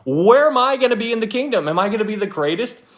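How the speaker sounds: high-pitched fretting; mock anxious question. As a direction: act out someone worried about their rank. Voicing everybody anxious about rank.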